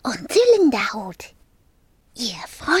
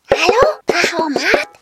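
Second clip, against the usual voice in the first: loud, raised questioning hello; calling out; 3 semitones higher, 7 dB louder than usual. A narrator's reading. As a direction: call up to a machine, unsure of an answer. Dragon calls to the machine.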